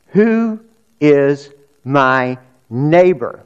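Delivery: each word drawn out slowly, weighing the question; slow emphatic question. Acted. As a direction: let the question hang, slowly and deliberately. Preacher slowly poses key question.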